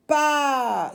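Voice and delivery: demonstrating pa as one long syllable; one-breath syllable. Shown as a demonstration. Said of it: "c'est une syllabe".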